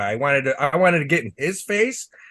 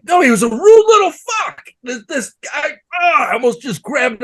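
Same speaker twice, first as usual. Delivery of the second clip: much higher and louder, furious outburst; real anger; 9 semitones higher, 5 dB louder than usual. Real, not acted. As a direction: let the anger burst out, loud and high. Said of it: "I was so pissed".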